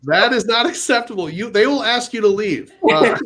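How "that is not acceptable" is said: ordinary delivery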